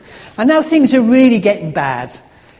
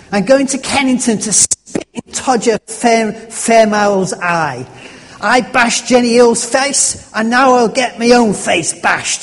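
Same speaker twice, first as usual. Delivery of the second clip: attempted Cockney; 3 semitones higher, 3 dB louder than usual. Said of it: "I can't do the accent".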